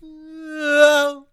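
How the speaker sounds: high, loud startled no; shocked no. Real, not acted. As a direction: recoil in shock.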